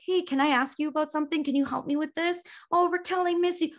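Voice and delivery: lightly mocked, high sweet pleading; little brother. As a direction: pipe it up high like a pleading younger sibling. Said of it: "almost every day to be like".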